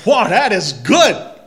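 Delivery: a hint of God's big proud voice; God admiring creation. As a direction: beam it out like a pleased creator. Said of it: "and said".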